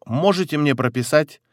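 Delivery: normal talking voice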